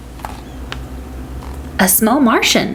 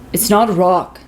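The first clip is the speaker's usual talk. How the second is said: dad voice. A narrator's reading. Dad answers the Martian.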